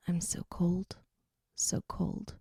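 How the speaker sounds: cold, whispered and shaky; shivering whisper. A narrator's reading. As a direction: whisper it, chilled through. Shivering in the shower.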